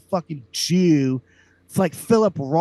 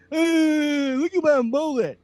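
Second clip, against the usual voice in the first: mock Theo Von; 11 semitones higher, 4 dB louder, slower than usual. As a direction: ridicule him in a squealing hillbilly caricature. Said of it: "I would talk like this too".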